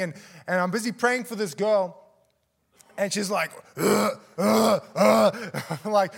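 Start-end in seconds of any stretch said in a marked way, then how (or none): 3.8-5.6 loud mock heaves, one after another; imitated retching. Preacher mimicking girl about to vomit, imitated retching.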